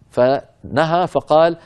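ordinary delivery

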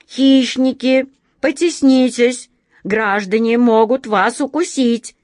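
громко, выше, сбиваясь; кричать в панике; взволнованно. Acted. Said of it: "от волнения опять всё перепутал". (сбивчиво от волнения)

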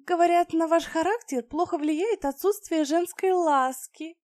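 high, flirtatious purring voice; femme fatale. Acted. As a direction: tease him, flirt openly. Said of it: "заигрывающе взмахнула ресницами".

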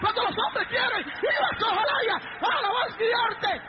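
high, shouted preaching; full-force preaching. Real, not acted. Preacher stirring up the congregation, full-force shouting.

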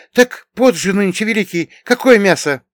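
high, loud surprised character voice; astonished. A narrator's reading. Bogdan questioning his companion about meat in fasting time, astonished. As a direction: protest in high-pitched, loud amazement. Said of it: "после с удивлением протянул".

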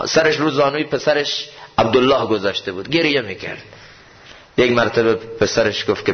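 ordinary delivery

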